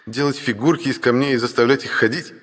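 giggling. Acted. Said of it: "хихикнул брат".

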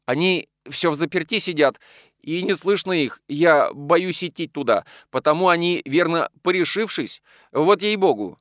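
high, anxious account; trembling with fear. A narrator's reading. Maid afraid to enter the locked house, fearful. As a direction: plead to be believed, understated fright. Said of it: "вся дрожа".

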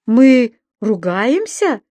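slow, drawn-out puzzled question; surprised. A narrator's reading. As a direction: ask back in wonder. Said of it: "удивлённо переспросил Субастик".

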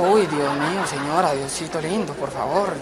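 a priest lightly voiced, praying under his breath; shocked priest. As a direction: mutter it like a flustered old priest. Priest reacts, voice dropping.

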